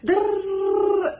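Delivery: high rolled "drrr" for a rant; imitated ranting. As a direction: trill out the noise of a mock tirade. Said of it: "this lady is going off".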